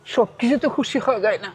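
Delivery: high voice, really crying; tearful. Real, not acted. Poor food seller explaining tears of joy to benefactor, tearful. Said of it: "কান্না করতেছেন কেন".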